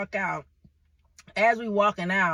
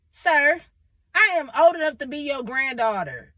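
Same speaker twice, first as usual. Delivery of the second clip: put-on louder and higher, scolding an old man; indignant scolding; 6 semitones higher, 7 dB louder than usual. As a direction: call him out, appalled.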